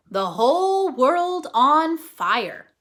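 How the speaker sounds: slow, loud, grand announcement; dramatic title. A narrator's reading. Teacher announcing the chapter title, slow grand read.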